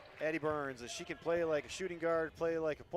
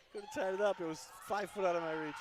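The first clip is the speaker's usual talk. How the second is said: laughing; 6 semitones higher, faster than usual. Real, not acted. Repeats it laughing.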